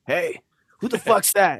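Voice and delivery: nursing-home resident. Acted. Imagined nursing home resident reacts.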